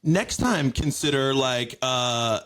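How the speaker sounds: high, prim appliance voice; mock fridge voice. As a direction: lecture like a smug smart fridge. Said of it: "it would be like".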